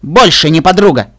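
ordinary delivery, nothing marked

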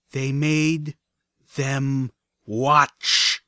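each word of They made them watch punched, rising to a loud watch; each word emphasized. Acted. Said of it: "I emphasized each word with a stomp".

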